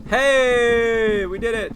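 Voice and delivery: high, loud victory shout; triumphant cheer. Real, not acted. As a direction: cheer the win. Celebrating puzzle solved.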